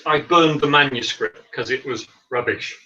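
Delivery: ordinary delivery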